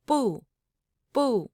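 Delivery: "boo" high at the start, sliding down; high falling tone. Shown as a demonstration. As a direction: drop "boo" from high like an exclamation. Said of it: "high falling".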